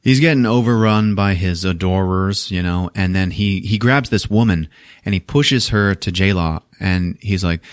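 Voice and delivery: ordinary talk, nothing marked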